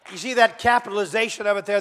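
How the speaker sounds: ordinary delivery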